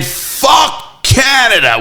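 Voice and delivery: high, drawn-out mock shout; imitated announcer. The guy at the end of the game, imitated long high shout. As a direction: stretch it out as an announcer's high call. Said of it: "the guy goes".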